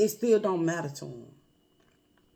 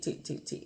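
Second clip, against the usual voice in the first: breathy whispered tick tick tick, much softer; 13 dB quieter than usual. Retold or imitated. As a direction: whisper the ticking like a tiny clock. Pacemaker ticking heard as child.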